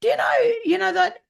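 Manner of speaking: high, loud burst of amazement; excited exclamation. Retold or imitated. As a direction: cry it out in amazement. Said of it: "And I thought".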